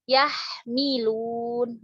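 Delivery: yahmilun drawn out very slowly, each sound clear; slow demo word. Shown as a demonstration. (yahmilun diucapkan sangat pelan dan jelas)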